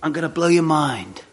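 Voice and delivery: lightly voiced intense friend; a hint of an eager buddy promising a revelation; mild imitation of a keen fisherman friend. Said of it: "he goes".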